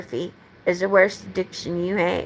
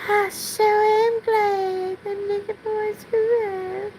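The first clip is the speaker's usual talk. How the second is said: much higher, slow put-on Southern belle; 10 semitones higher, slower than usual. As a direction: gush it out like a high-pitched Southern belle. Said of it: "I still have to speak like a Southern belle".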